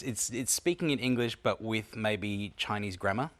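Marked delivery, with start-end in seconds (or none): none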